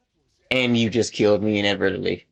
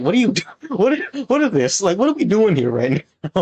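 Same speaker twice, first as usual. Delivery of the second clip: laughing, higher-pitched mock bewilderment; baffled disbelief; 8 semitones higher than usual. Retelling own reaction to the opponent's voice, baffled disbelief. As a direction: blurt it out in amused disbelief, pitched up.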